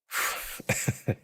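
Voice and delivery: quiet wheezing laugh; laughing too hard to talk; breathless laughter. Real, not acted. Overwhelmed laughing at chat.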